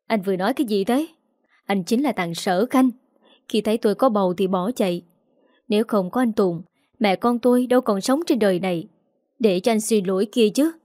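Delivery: ordinary delivery, nothing marked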